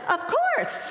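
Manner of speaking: high, bursting with eagerness; eager yes. Retold or imitated. To the CEO who bought them an RV, an excited high yes. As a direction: jump at the offer, voice soaring high. Instantly accepting the RV job.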